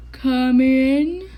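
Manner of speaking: hoarse rasp from a sick bear. A narrator's reading. Sick bear rasping to a visitor at the door. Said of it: "he rasped".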